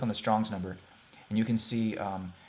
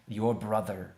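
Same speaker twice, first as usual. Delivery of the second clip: ordinary delivery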